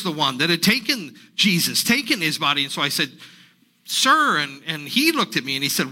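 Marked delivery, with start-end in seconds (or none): 3.8-4.4 high, loud call for attention; sudden pleading cry; urgent call. Acted. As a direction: call out to the stranger. Mary calls out to the stranger.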